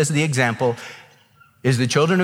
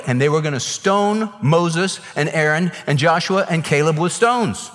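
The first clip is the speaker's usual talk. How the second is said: ordinary talk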